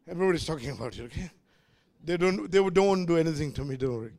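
low, soft reassuring aside; hushed aside. Real, not acted. Preacher reassures congregation after political remarks, hushed aside. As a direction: drop right down, as if off the record.

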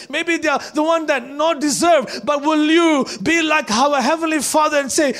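loud, high, driving sermon; full-force preaching. Acted. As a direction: preach it to the whole church.

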